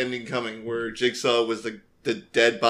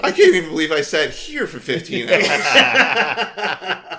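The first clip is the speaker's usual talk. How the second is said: laughing disbelief; 6 semitones higher, 4 dB louder, slower than usual. Real, not acted.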